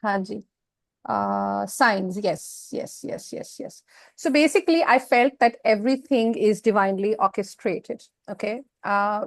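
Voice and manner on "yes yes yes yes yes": whispered to self. Real, not acted. Whispered to herself reading notes.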